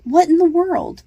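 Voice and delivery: high, loud startled gasp of a question. Acted. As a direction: blurt it out, caught off guard. Heroine startled by a cry.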